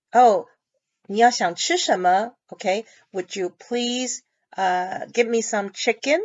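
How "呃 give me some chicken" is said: normal talking voice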